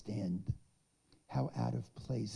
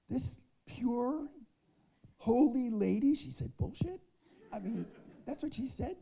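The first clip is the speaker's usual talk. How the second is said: much higher, incredulous; amazed disbelief; 12 semitones higher, 4 dB louder than usual. Real, not acted. Speaker recalls the holy lady swearing in disbelief. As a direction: sound scandalised and delighted.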